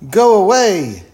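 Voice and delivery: high, loud angry crowd yell. A narrator's reading. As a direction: shout them away, high and forceful. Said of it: "yelled the people".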